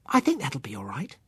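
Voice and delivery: put-on whispered little girl; sleepy child whisper. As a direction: whisper it like a child nearly asleep.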